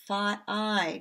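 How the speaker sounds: Fi- then I, vowel pulled out alone; sound split. Shown as a demonstration. Teacher splitting the word five for kids. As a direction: break Fi- apart and say I on its own. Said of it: "So when I say it".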